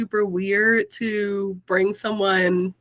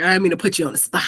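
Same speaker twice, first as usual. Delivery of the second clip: ordinary talk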